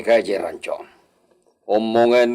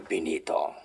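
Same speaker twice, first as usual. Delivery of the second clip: soft, unvoiced whisper; 7 semitones lower, 14 dB quieter than usual. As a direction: keep it secret. Secretive question to servant. (pabulong na tanong)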